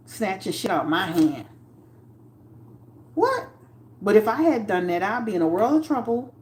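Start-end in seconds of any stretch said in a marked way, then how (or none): 3.1-3.5 very high single "What?"; outraged, stunned squeak; much higher, indignant; shocked what. Retold or imitated. Coworker snatching from her hands.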